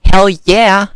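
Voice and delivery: fired-up, punchy exclamation; emphatic cheer. Real, not acted. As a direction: shout the answer with gusto. Emphatic answer to own question.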